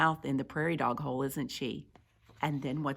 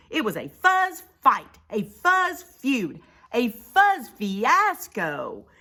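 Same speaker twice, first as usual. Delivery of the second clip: loud, high, punchy storytelling; excited story narration; 8 semitones higher, 7 dB louder than usual. A narrator's reading. Narrator describing war between the fuzzes, excited and emphatic. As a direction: narrate a brawl for young listeners.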